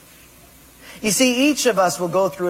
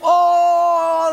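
mocking oh; 10 semitones higher, 6 dB louder than usual. Portuguese guy laughs at Japanese guy.

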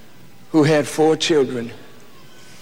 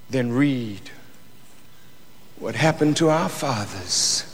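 much softer, intense hushed sermon; hushed preaching; 8 dB quieter than usual. Acted. Preacher to congregation about the pain of Jesus, hushed. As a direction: drop to a near hush to draw them in.

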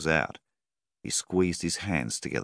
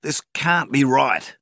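slow, much higher and louder, seething fury; 11 semitones higher, 9 dB louder, slower than usual. A narrator's reading. Farmer seething at the banker, slow and loud. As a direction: grind each word out in rage. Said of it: "seethed Milvan".

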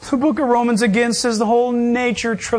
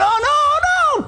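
high, drawn-out mock wail of alarm; hammed-up comic panic shout; panicked deer; slower than usual. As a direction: cry out like a deer about to be hit. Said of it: "That deer's trying to make that crossing".

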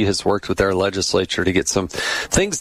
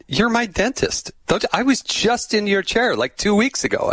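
pitched-up, mildly mad mistaken stranger; retold indignant insistence; 12 semitones higher than usual. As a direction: imitate an annoyed stranger insisting he knows you. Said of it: "then he get mad at me".

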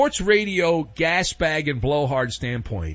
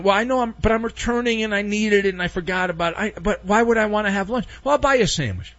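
higher, rushed, fumbling excuses in a put-on voice; flustered Kelly impression; 5 semitones higher, faster than usual. Host playing Kelly making excuses to the school, high and rushed. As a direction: babble the excuses fast, like a caught guy trying to smooth it over.

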